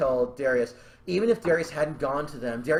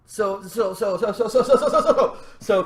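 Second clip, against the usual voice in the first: repeated word climbing higher and louder; giddy buildup; 4 semitones higher, 4 dB louder than usual. Real, not acted. Writer launching a new idea, giddy buildup. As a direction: rev up, rising into a laugh.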